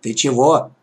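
ordinary delivery, nothing marked

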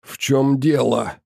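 rasping, gurgling monster voice; hoarse gurgling voice. Acted. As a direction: croak it out wet and hoarse. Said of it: "хриплым, булькающим голосом спросила тварь". (хриплый булькающий голос)

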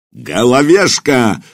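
slow, drawn-out mild roar of a name. Acted. Furious centurion summoning his man, slow mild roar. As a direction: bellow the name, stretched out. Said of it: "бешеным туром взревел".

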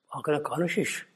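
ordinary talk, nothing marked

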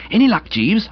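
high, hopeful question; eager. A narrator's reading. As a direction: ask Jeeves keenly for news. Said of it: "we hailed him eagerly".

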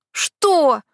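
high, loud indignant blurt; indignant outburst. Acted. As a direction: blurt it out, outraged. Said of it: "наконец выпалил возмущённо он".

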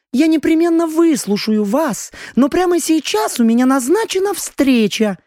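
high, dainty countess voice; gentle girlish voice. Acted. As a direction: recite it like a prim young lady. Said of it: "нежным девичьим голосом".

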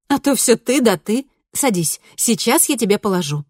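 lightly voiced cheerful teen girl; bright daughter voice. A narrator's reading. Cheerful daughter offers breakfast.

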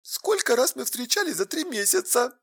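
very soft, high, hurt question; hushed reproach; tearful challenge. A narrator's reading. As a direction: ask it in a small wounded voice. Tearful girlfriend challenging him.

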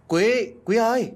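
high, urgent shout of a name; frantic calling. A narrator's reading. Friend rushing in to fetch Quý, frantic call. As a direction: call out in alarm. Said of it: "tiếng gọi hớt hải của Tài".

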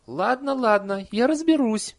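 very high, loud nanny voice; placating promise. A narrator's reading. Nanny promises villagers to sort it out, placating. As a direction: cut the complaints short with a promise. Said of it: "пообещала нянюшка".

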